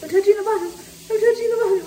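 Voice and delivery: high, loud cold-shock yelps; in an ice bath. Real, not acted. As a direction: squeal from the icy water.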